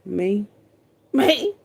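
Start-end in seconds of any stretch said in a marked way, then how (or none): 1.1-1.5 surprised. Real, not acted. Mock surprised reply.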